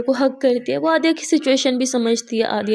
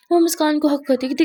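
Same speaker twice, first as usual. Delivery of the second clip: ordinary delivery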